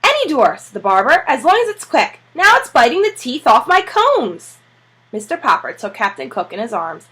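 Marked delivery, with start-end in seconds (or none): 0.0-0.6 higher, louder barber voice; impatient barber. Acted. Barber telling Mister Popper to leave, impatient and high. 1.3-4.6 high, loud, exasperated barber; the bird's wrecking my combs, exasperated. Acted. Barber complaining to Mister Popper, high exasperated.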